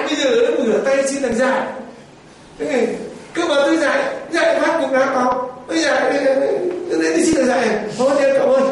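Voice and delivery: high, loud sulky complaining; whining Peter. Acted. Peter complains to John about begging bread, high and loud. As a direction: moan like a wounded disciple who was called foolish. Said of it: "Thế anh ra là".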